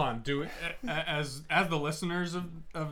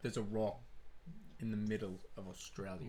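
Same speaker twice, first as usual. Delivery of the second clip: soft, low Australian accent attempt; 3 semitones lower, 9 dB quieter than usual. Shown as a demonstration. Co-host retrying the line to himself, soft Australian accent. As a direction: quietly practise an Australian accent.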